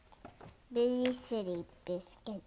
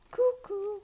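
higher, louder cuckoo; cuckoo call; 9 semitones higher, 5 dB louder than usual. Acted. Bird calls at the running puppy, loud cuckoo.

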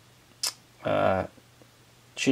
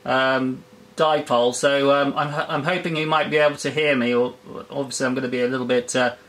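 on-air radio voice; 2 semitones higher than usual. Real, not acted. Live on-air radio contact.

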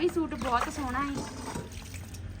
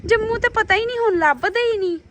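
excited exclaiming; 7 semitones higher, 6 dB louder than usual. Real, not acted. Exclaims about missing fruit.

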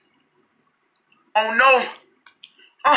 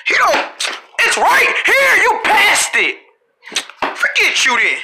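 exasperated shout; 8 semitones higher than usual. Acted. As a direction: call out, fed up. Frustrated kid calling out.

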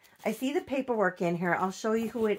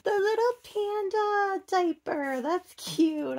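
much higher, cutesy mock-gushing voice; mimicking fans; 10 semitones higher, 4 dB louder than usual. Vlogger mimicking other collectors gushing over panda diapers. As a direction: parody a fan squealing over a diaper. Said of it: "I hear them say".

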